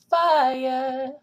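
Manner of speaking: "fire" with a light, barely curled R; fire without a curled R. Shown as a demonstration. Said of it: "don't make the R really curled".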